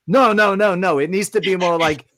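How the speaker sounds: high, loud insistent no; insistent director. Retold or imitated. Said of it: "they're like".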